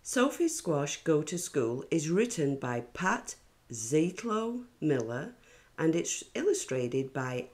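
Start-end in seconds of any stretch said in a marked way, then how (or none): none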